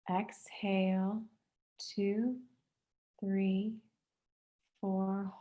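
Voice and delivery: measured count with long pauses, each word drawn out; guided breathing. Real, not acted. Instructor counts participants through box breathing, calm guided-meditation voice. Leads a paced breathing exercise.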